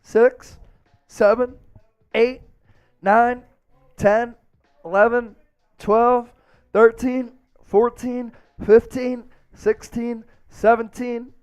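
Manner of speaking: one number per rep, lifted pitch; counting push-ups. Real, not acted. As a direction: count steadily through the effort of push-ups. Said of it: "I'll count 'em out".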